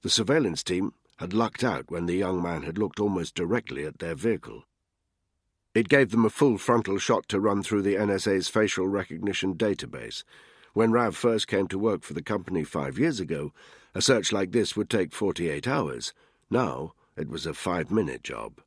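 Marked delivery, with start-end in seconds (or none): none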